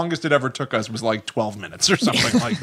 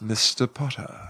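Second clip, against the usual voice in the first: put-on British accent; 2 semitones lower, 6 dB quieter than usual. A Hogwarts teacher addresses Harry in a British accent. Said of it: "That's British".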